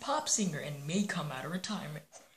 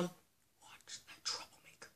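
quick hushed order; whisper; 17 dB quieter, slower than usual. Acted. Link whispers an order to his friend. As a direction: whisper the warning.

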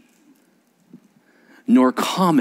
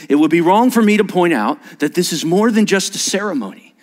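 formal ceremony reading; 6 semitones higher, 3 dB louder than usual. Shown as a demonstration. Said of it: "I start out the ceremony like this".

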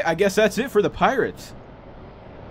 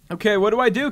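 usual speaking voice

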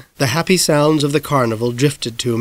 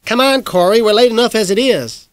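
higher, louder call to a friend; 9 semitones higher, 3 dB louder than usual. A narrator's reading. Davy Ray calling Corey to hurry, raised voice. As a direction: call across the yard. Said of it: "Davy Ray called to me".

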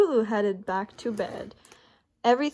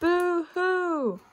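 higher, wailing boo-hoo; mock crying; 7 semitones higher than usual. A narrator's reading. Sad birds crying outside, a mock wail. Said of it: "somebody somewhere said".

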